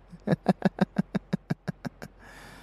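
high cackle that trails away; wicked cackle. Acted. Villain performing for the dead, high wicked cackle. Gleeful villain after the show.

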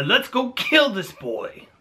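slow, high, relishing evil king; gleeful villain Saul. Retold or imitated. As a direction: savour the threat like a cartoon villain. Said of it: "And Saul's like".

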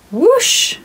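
ordinary delivery

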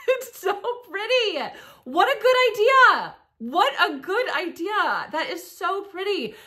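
high, loud excited squeal of delight; delighted gushing. Real, not acted. Vlogger squealing to Patricia about her fence window. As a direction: gush over the clever idea.

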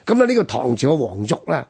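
normal talking voice

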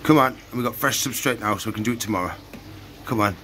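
ordinary talk

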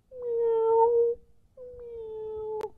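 high mew fading away; a small kitten crying behind the door; kitty meow. Acted. A kitten crying outside for the lady to hear. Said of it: "hear the little kitty outside".

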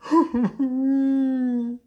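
loud, very high ghost wail; hammed-up "huhuu" bawling; howling sob. A narrator's reading. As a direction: howl like a frightened little ghost. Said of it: "heulte Bibi".

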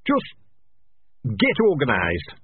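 plain reading voice

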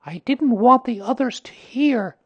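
put-on high child character, confiding in a friend; Christopher Robin. A narrator's reading. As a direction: pitch it right up for a young boy. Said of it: "said Christopher Robin".

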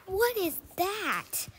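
loud, high, drawn-out alarm; shocked. Real, not acted. Player spots something horrifying in the game, shocked. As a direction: cry out slowly in shock.

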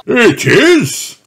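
put-on loud, high jolly old rabbit; Grampy Rabbit voice. Grampy Rabbit greets Mandy, jolly old rabbit. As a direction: greet like a hearty grandpa.

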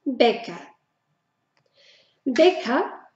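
Bäcker said as Beca; German pronunciation. Shown as a demonstration. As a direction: open long E in Bäcker. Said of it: "Beca significa panadero". (pronunciación alemana de Bäcker)

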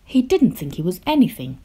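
usual speaking voice

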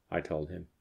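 plain reading voice

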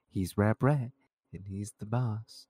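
ordinary delivery

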